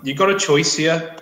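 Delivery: ordinary delivery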